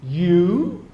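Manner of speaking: loud, drawn-out YOU as a question; emphatic YOU. Acted. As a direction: lean hard on YOU, incredulous question tune. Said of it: "personal pronoun here as emphatic".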